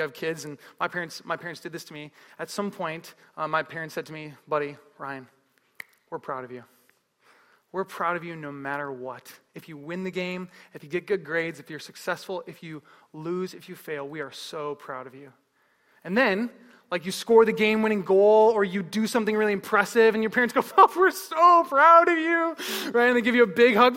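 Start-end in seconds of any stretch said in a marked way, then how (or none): 4.5-15.3 low, soft and sincere; tender parental reassurance. Retold or imitated. Parents to their son about unconditional pride, tender reassurance. 20.8-22.5 loud, high, drawn-out gushing; gushing parental pride. Retold or imitated. Parents after the game-winning goal, gushing proud cheer.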